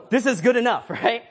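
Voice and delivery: laughing it off, high and loud. Retold or imitated. Uncle laughing at his own botched repair to the kids. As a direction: laugh through the fake fix. Fudging the broken toy fix.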